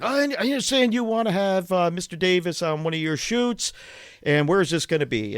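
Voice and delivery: a hint of a stiff, official agent. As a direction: play the officious agent, lightly. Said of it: "he's acting all official".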